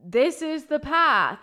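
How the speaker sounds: slow, loud, drawn-out voice from the sky; booming loving voice. Retold or imitated. Face from the sky shows her the path, slow and booming. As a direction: lay out the way like a kind giant. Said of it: "in a booming voice, like a loving voice".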